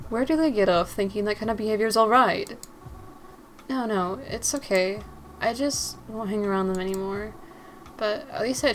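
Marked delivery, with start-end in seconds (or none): none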